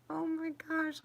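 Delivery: high amazed exclamation; surprised. Real, not acted. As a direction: gasp it out high. Amazed at her own clumsiness.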